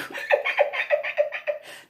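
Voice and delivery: high, breathless squealing laugh; high wheezing laugh. Real, not acted. High squealing laugh after quoting the cousin. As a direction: let a helpless high laugh out.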